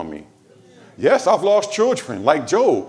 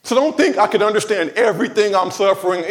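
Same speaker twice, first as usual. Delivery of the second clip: ordinary talk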